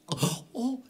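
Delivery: put-on small, very high child voice, a little scared; a timid boy answering Santa; a bit frightened. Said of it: "sagte Thomas etwas ängstlich".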